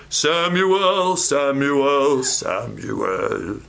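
same name stepped down from middle to low pitch; wrong calling voices. Shown as a demonstration. Preacher showing how God's call never sounds, stepping down in pitch. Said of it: "It's never".

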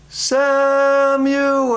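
high, long-drawn call. Acted. God calls to sleeping Samuel in the night, long high call. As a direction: stretch the name out on a high, held note. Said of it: "He hears it again".

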